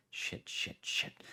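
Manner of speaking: whispered inner panic; panicked whisper. Acted. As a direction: breathe it out in panic. Ekro panics inwardly.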